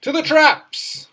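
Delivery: high, loud heroic call; rallying cry. Acted. Gamer rallying his pet Chester, loud heroic call. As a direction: sound the charge to the traps.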